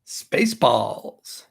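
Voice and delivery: "Spaceballs" stretched very slowly; slow drawn-out title. Acted. Naming the movie, slow and drawn out.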